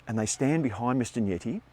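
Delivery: ordinary talk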